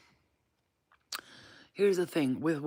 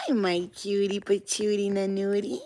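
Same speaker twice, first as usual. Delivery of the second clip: higher, sing-song pet greeting; 4 semitones higher than usual. Real, not acted. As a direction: coo playful nonsense endearments at a pet. Greeting pet with nonsense endearments.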